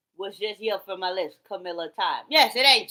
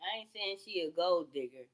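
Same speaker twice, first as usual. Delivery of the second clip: usual speaking voice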